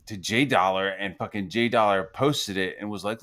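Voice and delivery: normal talking voice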